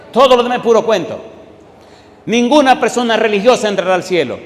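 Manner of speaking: loud and fast, hammering the point; fervent preaching shout. Real, not acted. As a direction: shout it out to the congregation.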